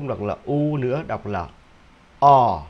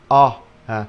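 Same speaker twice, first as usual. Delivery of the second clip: British thought; 5 dB louder, slower than usual. Shown as a demonstration. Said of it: "âm của Anh".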